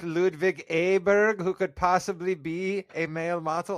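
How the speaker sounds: name the Swede in a playful mock German accent. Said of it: "I don't know why I went German on that".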